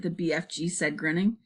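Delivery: ordinary delivery, nothing marked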